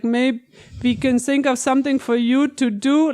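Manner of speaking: put-on German-accented quote, crisp sounds on the English words; imitated German accent. Quoting Sam Rockwell's spot-on line to co-host, German accent. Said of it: "that line where he's like".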